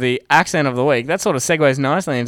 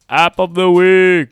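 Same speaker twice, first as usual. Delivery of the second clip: loud, drawn-out segment title; segment announcer; 6 semitones higher, 9 dB louder, much slower than usual. Pretend. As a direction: announce it big and slow.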